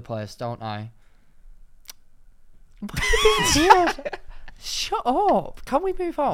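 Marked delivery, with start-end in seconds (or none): 2.8-6.3 much higher odd put-on voice, flustered; silly put-on voice. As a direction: squeak it out in a goofy high voice. Embarrassed outburst, odd voice.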